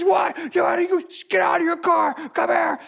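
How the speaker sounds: high, hammed-up angry shout; mock trucker shouting. Trucker taunting the driver to get out, hammed-up shout. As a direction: taunt him out of the car. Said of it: "He's screaming and yelling".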